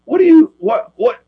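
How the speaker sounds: can't believe he asked that; incredulous what. Real, not acted. Guest taken aback by host's shirt question, incredulous.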